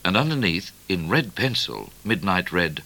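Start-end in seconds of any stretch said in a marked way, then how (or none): none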